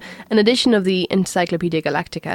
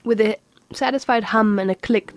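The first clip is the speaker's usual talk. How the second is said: usual speaking voice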